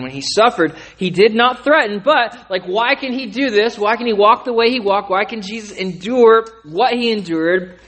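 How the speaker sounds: building to a loud, high, impassioned climax; rising preacher fervor. Real, not acted. Preacher building to full force.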